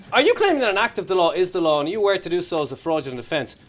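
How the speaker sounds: ordinary talk, nothing marked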